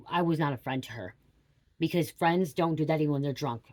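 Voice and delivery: ordinary talk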